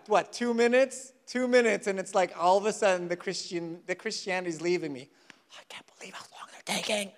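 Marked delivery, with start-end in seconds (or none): 5.5-7.1 whispered impatient complaint; impatient grumble. Acted. Impatient customer waiting at Tim Hortons, whispered grumbling. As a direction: fume under your breath in a whisper.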